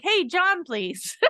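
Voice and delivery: breezy phone greeting, falling at the end; friendly, familiar. Shown as a demonstration. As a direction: greet him like you're calling a friend. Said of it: "sound like you're calling a friend".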